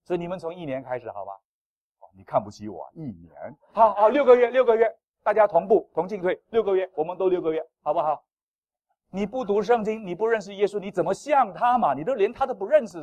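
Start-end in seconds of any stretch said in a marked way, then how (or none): 3.7-13.0 higher, louder urging preacher; rally the flock to join in; rallying preaching. Acted. Pastor urges the congregation to read together, rousing.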